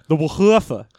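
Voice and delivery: "woofa" as an airy "wuh-HOO-fa"; hard breathy H. Shown as a demonstration. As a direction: hit a big H inside "woofa". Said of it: "really gotta hit that H".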